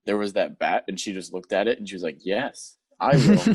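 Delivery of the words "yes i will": hammed-up eager answer. Mina answers the bat.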